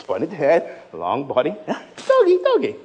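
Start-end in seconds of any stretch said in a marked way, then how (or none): 1.7-2.8 put-on little kid. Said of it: "Just like a little kid sees a dog".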